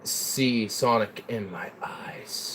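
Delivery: slow, breathy, menacing whisper; creepy whisper. Pretend. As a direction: whisper it like a haunting.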